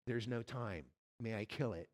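quietly insist, no hurry; quiet angel. Acted. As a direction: angel insisting to the ghost, low and soft. Angel answers the ghost.

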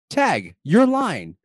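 slow, drawn-out segment title; announcer voice. Acted. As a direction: announce it like a show host.

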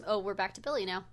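plain reading voice

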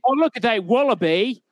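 high, hammed-up Aussie twang; Australian accent. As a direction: play the cartoon Australian spotting a wallaby. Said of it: "you be Crocodile Dundee".

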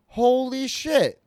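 high, slow, drawn-out "Holy shit"; stunned disbelief. Retold or imitated. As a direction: let it slide up in shock. Recognizes old teammate as cop.

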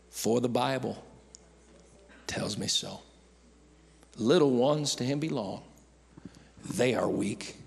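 slow, soft, low hushed recitation; soft recited song. Acted. Pastor recalling a Sunday school song, hushed. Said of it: "the Sunday school song".

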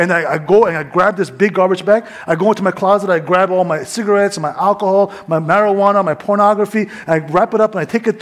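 ordinary talk

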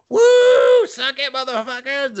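loud, very high shouted whoop, then high jeer; mock gloating whoop. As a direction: crow at enemies while flying past. Acted gloating flyby in helicopter.

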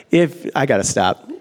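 usual speaking voice

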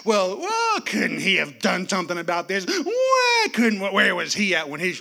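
mock crying mourners complaining; backbiting mourners. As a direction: whine like the grumbling crowd. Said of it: "he can hear them backbiting".

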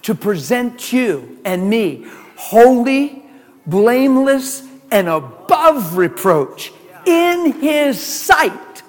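slow scripture quote. Shown as a demonstration. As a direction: lift each word of the verse slowly. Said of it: "I'm quoting Colossians one".

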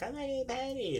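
high, soft imitation of the co-host; mimicked denial. Host retelling the co-host's answer, high mock denial.

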